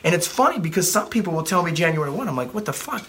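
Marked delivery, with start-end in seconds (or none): 2.5-3.1 higher, mock disbelief, dropped in level; a pitched-up what-are-you-thinking; incredulous. Coach reacting to a client who wants to wait, mock incredulous.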